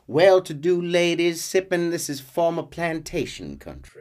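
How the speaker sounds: put-on Southern American lilt; Southern American accent. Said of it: "do a Southern American accent".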